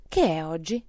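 mild call to someone below; understated shouted question. A narrator's reading. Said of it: "gridò Scrooge".

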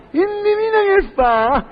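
"Indovina che fa?" slow and high-pitched; heightened suspense. Acted. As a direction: raise and stretch "Indovina che fa?". Repeat, raised for suspense.

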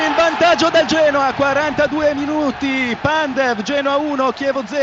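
loud, urgent goal update; loud report. Real, not acted. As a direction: shout the score over the crowd. Reporter breaks in loudly. (aggiornamento gridato)

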